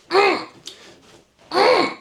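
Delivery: high, sharp "mm" with each swing; mock effort grunts. Miming backhands at someone talking nonsense, mock effort grunts. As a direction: punch out high-pitched comic grunts.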